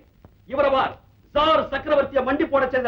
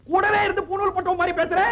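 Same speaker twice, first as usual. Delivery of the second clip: indignant shout; 5 semitones higher than usual. Acted. Man retorts at shopkeeper.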